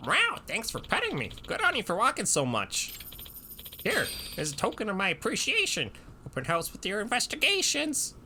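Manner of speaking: high squeaky cat voice. Pretend. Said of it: "That's my cat voice".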